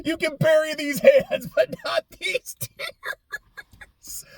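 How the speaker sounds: very high squeaky mock sob, dragging out the end; mock crying. Gamer joking about tears they can't fight, squeaky mock cry. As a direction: squeal it like a laughing, tearful wail. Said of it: "probably going to start crying at some point".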